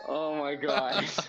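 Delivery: slow "oh my gosh" through laughter; laughing in disbelief. Real, not acted. Reacting to surprise answer.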